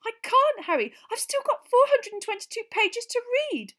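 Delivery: high, strained refusal; high-pitched. A narrator's reading. Hermione refusing Harry's offer, strained high voice. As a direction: stay tight and high. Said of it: "strangely high-pitched voice".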